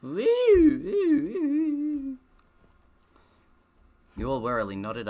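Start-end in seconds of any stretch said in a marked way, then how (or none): 0.0-2.2 high, loud startled cry; sudden yelp. Real, not acted. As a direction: yelp out the word, much higher. Sudden outburst while reading.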